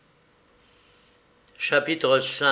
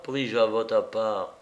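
ordinary delivery

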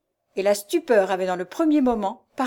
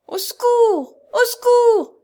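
very high, loud desperate cry; cry for help; 9 dB louder, slower than usual. A narrator's reading. Jeanne carried off by her abductor, crying for help. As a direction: call out in distress, far higher. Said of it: "criait Jeanne d'une voix étouffée".

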